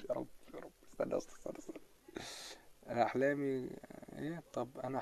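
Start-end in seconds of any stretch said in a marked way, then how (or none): 0.1-1.8 whisper. Real, not acted. The internet cut out.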